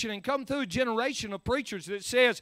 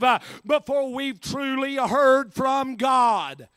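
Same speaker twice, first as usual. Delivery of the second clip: loud, weighty sermon delivery; emphatic preaching; 5 semitones higher, 10 dB louder, slower than usual. Real, not acted. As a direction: press each phrase on the congregation. Preaches at full force.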